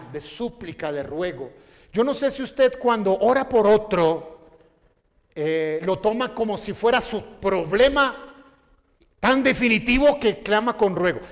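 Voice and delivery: ordinary talk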